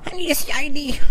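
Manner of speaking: high nasal mock voice; mocking bouncer. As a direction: mimic a fussy bouncer. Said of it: "Canadians are super strict".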